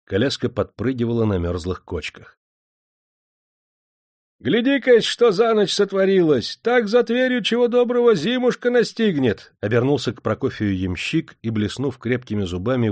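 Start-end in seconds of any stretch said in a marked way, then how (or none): 4.4-9.5 high, loud peasant coachman; loud, cheerful coachman shout; hearty coachman call. Acted. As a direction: call back over your shoulder to the master. Coachman calls out in the cold.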